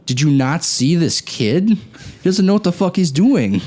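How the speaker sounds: incredulous protest, climbing high at the end; indignant retold protest. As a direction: argue back in disbelief, pitch rising. Retold indignant protest to go-kart staff.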